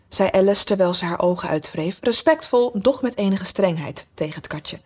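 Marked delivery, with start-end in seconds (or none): none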